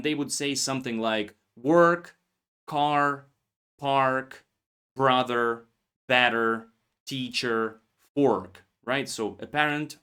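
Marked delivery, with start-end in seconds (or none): 1.6-8.6 work, car, park with a strong r, American style; American rhotic. Shown as a demonstration. Teacher demonstrates American English with strong r. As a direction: roll the r hard like an American. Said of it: "the same words in American English would have R".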